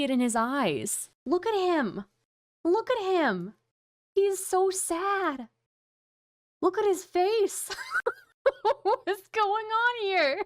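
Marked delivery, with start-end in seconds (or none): 1.2-5.5 gleeful disbelief. Real, not acted. Streamer pointing out a rival's sad face to viewers, gleeful disbelief. Gloating over streamer's visible sadness. 6.6-10.4 high, laughing disbelief; helpless laughter. Real, not acted. Streamer cracking up at a man's face on screen, helpless laughter.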